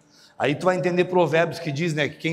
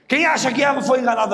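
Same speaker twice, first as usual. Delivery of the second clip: calling out; 8 semitones higher, 6 dB louder than usual. Real, not acted. Pregador interpela a plateia.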